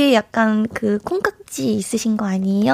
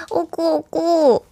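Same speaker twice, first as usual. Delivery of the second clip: breathy cutesy giggly; 5 semitones higher, slower than usual. Pretend. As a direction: coo it like a K-pop aegyo. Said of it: "애교 오 종 세트 한번 보여주세요".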